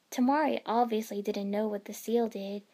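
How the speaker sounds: plain reading voice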